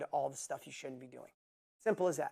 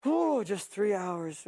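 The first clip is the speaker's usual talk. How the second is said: slow, sighing mock tiredness; lightly hammed-up weary groan; mock exhausted complainer; 3 semitones higher, 4 dB louder, slower than usual. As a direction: mimic someone moaning about no sleep. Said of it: "I'm never gonna be like".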